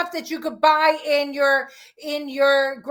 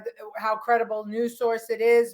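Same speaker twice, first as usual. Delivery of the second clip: ordinary talk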